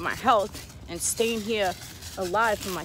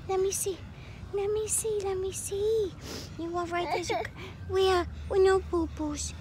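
high, tender cooing that swells to near tears; 9 semitones higher, 4 dB louder than usual. Real, not acted. Mother checking toddler after fall.

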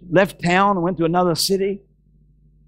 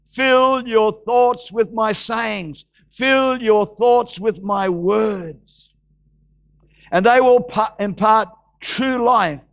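slow, drawn-out scripture proclamation; preach; 4 semitones higher, slower than usual. Acted. Preacher proclaiming scripture to the congregation, slow and declamatory. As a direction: declaim it solemnly, phrase by phrase. Reads scripture aloud at full force.

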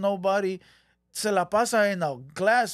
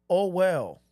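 normal talking voice

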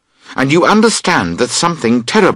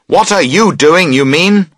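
higher, louder angry retort; answering angrily; 8 semitones higher, 5 dB louder than usual. A narrator's reading. Friend snapping back at the camper over the tent, angry retort. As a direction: throw the question back in anger. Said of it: "he answers angrily".

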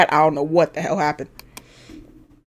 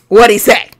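louder, eager and pushy; eager nosy question; 2 semitones higher, 10 dB louder than usual. Acted. Player gives in to nosiness, louder and eager. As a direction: demand the gossip, louder. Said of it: "my nosiness wins all around".

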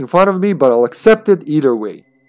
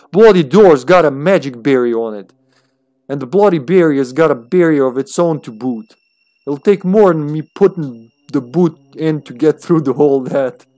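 put-on rough Chicago gangster with wannabe Australian accent. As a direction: read it as a tough twenties gangster, muddled Australian accent. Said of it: "the gangster".

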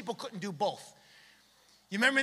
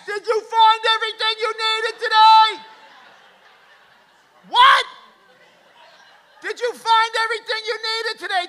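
loud, higher, muffled shout; yelling through glass; 11 semitones higher, 10 dB louder than usual. Retold or imitated. Cashier yelling the greeting through plexiglass, loud and muffled. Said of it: "yelling through the glass".

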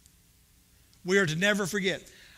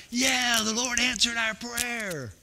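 excited relief; 5 semitones higher, 4 dB louder, slower than usual. Retold or imitated. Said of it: "And it's like".

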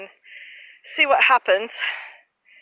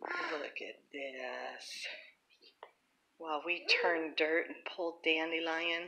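whispering; 6 semitones lower, 14 dB quieter than usual. Real, not acted. Sudden hush, whisper heard.

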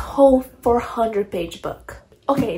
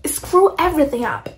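higher, louder fed-up outburst; exasperated rant; 7 semitones higher, 3 dB louder than usual. Real, not acted. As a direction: vent the frustration, pitch climbing. Ranting about a hated character.

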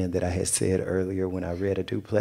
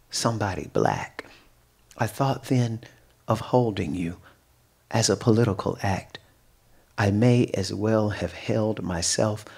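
poetry reading. Acted. Poet reading her poem to a live audience, measured recital. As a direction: let each phrase land, then breathe.

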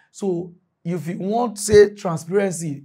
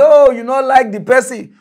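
mocking critics; 6 semitones higher, 8 dB louder than usual. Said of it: "una say".

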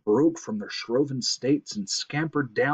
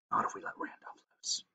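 soft, unvoiced plotting; hushed scheming squirrel; conspiratorial whisper; 11 dB quieter, faster than usual. A narrator's reading. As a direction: whisper the plan secretly. Squirrel secretly hatches a plan.

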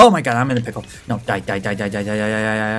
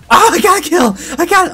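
high, loud burst of triumph; super excited; 13 semitones higher, 6 dB louder than usual. Real, not acted. Player thinking they got a kill, super excited. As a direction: blurt it out, thrilled. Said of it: "I got super excited".

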